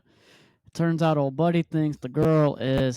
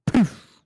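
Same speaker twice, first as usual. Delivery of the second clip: mock poof sound; 5 semitones higher, 6 dB louder than usual.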